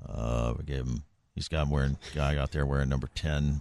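very low, soft monotone coach; Bill Belichick. Acted. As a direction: mumble evasively, low and quiet. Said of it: "we hear from Bill Belichick".

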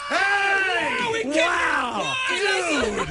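high whooping cheers; excited shout. Real, not acted. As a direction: cheer it like a big win. Host cheers a correct steal.

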